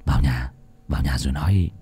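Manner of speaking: quiet urgent request; hushed urging. Acted. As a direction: keep it down, get him inside. Lĩnh urges him inside, hushed.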